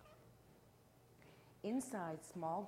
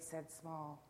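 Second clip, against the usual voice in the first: plain reading voice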